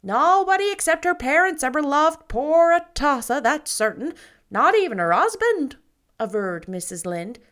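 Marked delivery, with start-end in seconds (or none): 0.0-5.8 put-on much louder, higher, opinionated old neighbor; gossiping matron. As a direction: hold forth like the village busybody. Mrs Lynde gossips.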